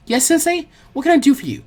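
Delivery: eager apprentice answering her teacher; young girl voice. Acted.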